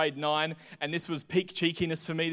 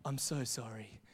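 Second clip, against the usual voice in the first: much lower, softer mock-sorry; fake apology; 8 semitones lower, 15 dB quieter than usual. Kid faking sorry to get out of room, overly soft. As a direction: lay on a fake, hollow sorry. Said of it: "do a fake apology".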